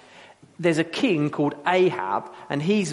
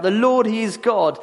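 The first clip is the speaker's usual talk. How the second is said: loud, raised-pitch proclamation; crying out; 6 semitones higher, 6 dB louder than usual. Retold or imitated. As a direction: cry it out like an awed crowd. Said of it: "they cry out".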